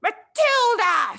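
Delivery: slow, drawn-out furious yell, high and loud; enraged headmistress yelling a name; roar. Acted. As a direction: bellow the girl's name. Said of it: "she roared".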